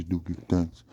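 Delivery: ordinary talk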